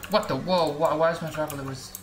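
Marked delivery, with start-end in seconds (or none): none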